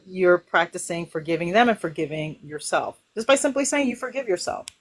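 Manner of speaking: ordinary talk, nothing marked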